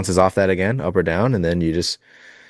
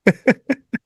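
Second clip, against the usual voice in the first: laughing; 4 dB louder than usual. Real, not acted. Laughing at the accent joke.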